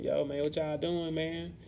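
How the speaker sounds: imitating calling out the restaurant; mock exasperated. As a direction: raise the voice as if you can't believe it. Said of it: "I'm like".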